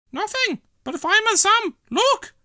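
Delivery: put-on very high, loud old lady voice; bursting to show it off; excited old lady. Dillis waving fivers at Fireman Sam, excited.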